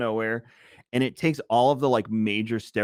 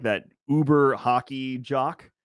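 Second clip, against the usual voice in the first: usual speaking voice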